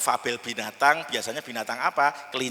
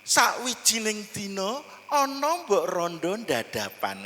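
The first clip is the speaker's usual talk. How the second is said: Javanese storyteller narration; 4 semitones higher than usual. Shown as a demonstration. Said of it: "kalau bahasa Jawanya kan gini".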